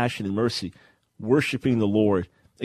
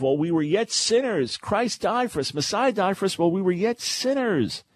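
scripture read with fervor; 9 semitones higher than usual. Real, not acted. Quoting scripture, raised pitch.

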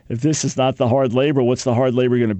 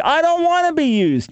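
put-on much higher, childish whine of refusal; whiny refusal; 13 semitones higher than usual. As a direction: balk like a sulky kid. Jeremiah refusing God's call.

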